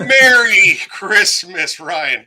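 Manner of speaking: excited welcome. Real, not acted. Host welcomes guest on stream.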